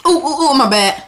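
high, loud startled ooh; startled apology. Real, not acted. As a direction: yelp it after a slip. Accidentally hits character.

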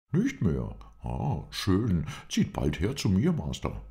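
lively, high character voice; pleasantly surprised. A narrator's reading. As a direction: exclaim it, delighted by the news.

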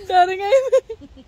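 high, loud, drawn-out words through laughter; laughing tease. Real, not acted. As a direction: crack up at their fright. Laughing at someone's fright.

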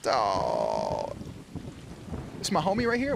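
ordinary talk

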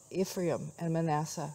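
ordinary delivery

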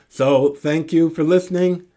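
ordinary talk

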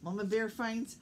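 ordinary delivery, nothing marked

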